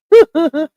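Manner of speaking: short loud laugh at her own joke; burst of laughter. Real, not acted. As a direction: let a real laugh burst out.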